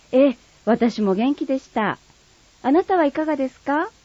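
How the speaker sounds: lightly voiced refined lady, polite standard speech; polite standard Japanese. Friend replying politely in light standard Japanese. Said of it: "標準語と鹿児島弁".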